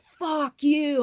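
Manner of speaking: loud, high re-enacted yell; retold scream. Screaming at her spirit guides, retold angry yell. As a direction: hurl it as a re-enacted angry shout. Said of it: "I was screaming at my spirit guides".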